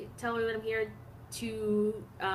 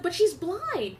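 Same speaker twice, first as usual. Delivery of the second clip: higher, louder plea; pleading; 7 semitones higher, 4 dB louder, slower than usual. Retold or imitated. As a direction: press the point. Keisha pleads for the narrator.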